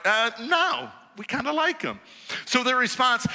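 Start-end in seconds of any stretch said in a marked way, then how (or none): 0.0-2.0 higher, hesitant, sheepish refusal; lightly voiced awkward guards. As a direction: shrug it off like caught schoolboys. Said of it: "they're like".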